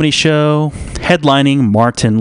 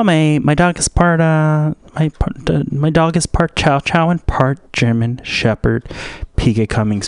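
usual speaking voice

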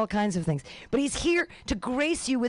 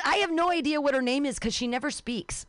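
ordinary talk

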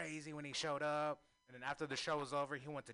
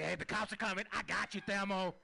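voicing Pam; 7 semitones higher, 5 dB louder than usual.

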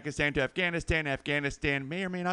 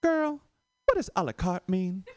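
higher-pitched woman's question; imitated girl asking; 5 semitones higher than usual. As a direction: ask a girlfriend, starting on a high "Girl". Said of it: "I hear one girl say".

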